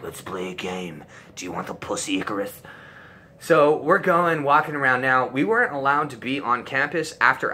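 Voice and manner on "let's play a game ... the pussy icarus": menacing puppet-master invitation; Jigsaw voice. Retold or imitated. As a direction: lower and softer creepy villain voice. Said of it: "it's like I'm fucking Saw on the jigsaw".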